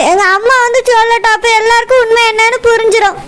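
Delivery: ordinary talk, nothing marked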